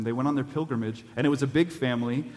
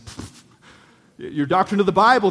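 a higher, louder mock scoff; 7 semitones higher, 8 dB louder than usual. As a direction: blow it off like a know-nothing. Thief scoffing at the angel.

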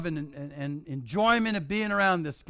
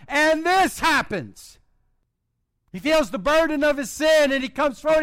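rising preaching voice, higher and louder; a preacher building the story toward its climax; building sermon; 5 semitones higher, 5 dB louder than usual. Acted.

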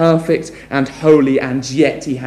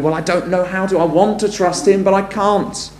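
higher, lightly pleading doubter; a hint of helpless wanting to trust; doubting believer; 7 semitones higher than usual. Preacher voices a listener who can't trust, higher and pleading. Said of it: "Others of you may be wondering".

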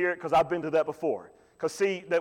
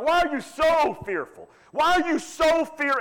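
rebuking Jesus; 11 semitones higher, 3 dB louder than usual. Retold or imitated. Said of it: "So Jesus is saying".

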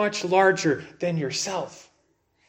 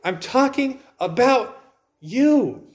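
slow, higher, landing hard on "you"; pointed emphasis; 7 semitones higher, slower than usual. Acted. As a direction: spell it out word by word, with a big rise and fall on "you". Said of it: "now he says, basically, in case you didn't get it".

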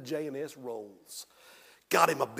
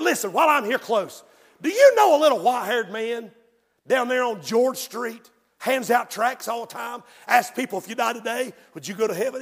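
acted inquiry, raised and loud; eager questioning; 5 semitones higher, 8 dB louder than usual. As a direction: press the pastor for an answer, louder and higher.

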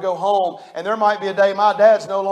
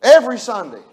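called out; 3 semitones higher, 8 dB louder than usual. Shown as a demonstration. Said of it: "y'all wanna hear it?".

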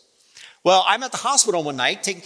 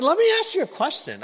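retold question; 13 semitones higher, faster than usual. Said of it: "And I said".

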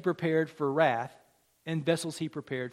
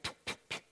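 three soft dismissive puffs of air; 12 dB quieter, faster than usual. Retold or imitated. Pastor imitates the king shooing servants, dismissive puffs. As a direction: puff softly as if shooing it off.

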